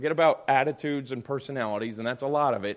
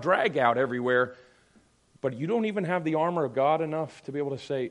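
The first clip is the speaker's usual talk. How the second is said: ordinary talk, nothing marked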